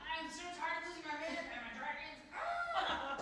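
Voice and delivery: wailing dramatic queen; overacted death scene. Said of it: "she's gonna be like".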